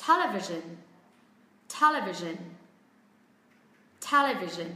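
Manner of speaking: Television as TEL-uh-vision, stressed on TEL; schwa second syllable. Shown as a demonstration. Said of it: "khác âm tiết thứ hai, nó là âm ơ".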